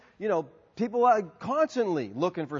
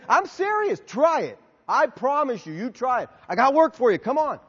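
high, loud, punchy urging; acted-out insistent coaxing; earnest insistence; 6 semitones higher, 6 dB louder than usual. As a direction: press them to take it seriously. Insists on his offer to panhandler.

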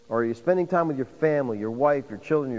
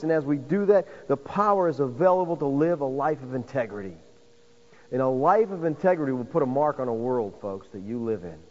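ordinary talk, nothing marked

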